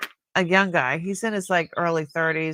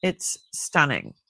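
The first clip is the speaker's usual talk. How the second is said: ordinary talk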